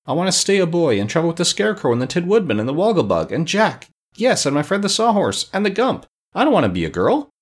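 put-on high, whiny child's plea; upset high-pitched protest; ready to cry. A narrator's reading. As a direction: plead plaintively as if near tears. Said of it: "ready to cry".

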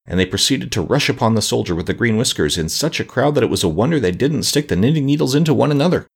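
plain reading voice

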